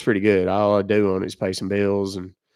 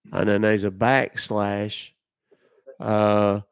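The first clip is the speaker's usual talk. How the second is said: ordinary talk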